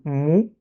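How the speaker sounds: "mou" with rounded kiss-like lips; lips pushed out. Shown as a demonstration. Teacher showing the dhamma vowel, rounded "mou". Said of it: "C'est comme on fait un bisou".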